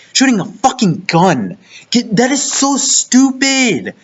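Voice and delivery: heated rant. Real, not acted. Fan ranting at anime lovers, incredulous and heated. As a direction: rant in disbelief, pitch rising.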